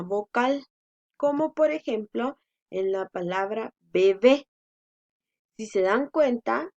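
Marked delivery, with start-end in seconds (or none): 3.8-4.5 bebé with force on the final syllable, be-BÉ; stress on last syllable. Shown as a demonstration. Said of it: "la mayor fuerza de voz la hacemos en la última". (bebé con fuerza en la última sílaba, be-BÉ)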